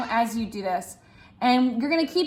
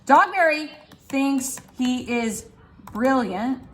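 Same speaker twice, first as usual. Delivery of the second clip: usual speaking voice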